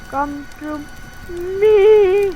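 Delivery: high, slow, booming summons; loud drawn-out call. Acted. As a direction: stretch it out like a spooky summons.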